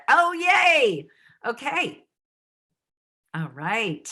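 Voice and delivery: usual speaking voice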